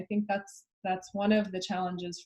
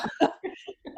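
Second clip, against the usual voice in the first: breathless laugh; 8 semitones higher than usual. Real, not acted. Joke punchline.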